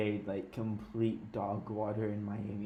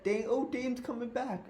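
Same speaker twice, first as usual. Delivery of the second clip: mocking imitation; 12 semitones higher than usual. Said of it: "You said".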